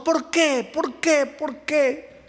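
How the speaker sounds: high, pleading cries of why; a heartbroken woman begging God why; despairing woman. Retold or imitated. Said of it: "Y ella comenzó". (mujer desesperada, voz aguda y suplicante)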